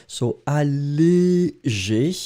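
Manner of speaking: "allégé" said slowly, each syllable clear; pronunciation demo. Shown as a demonstration. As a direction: model "allégé" slowly for the class. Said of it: "the way to pronounce it".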